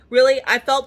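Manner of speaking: high, loud incredulous "Really?"; sarcastic really. Retold or imitated. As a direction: scoff at the claim loudly. Sarcastic reply to nurse's claim.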